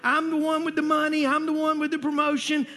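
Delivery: high, driving sermon build-up; impassioned preaching. Real, not acted. Preacher urging the congregation out of the grave, impassioned.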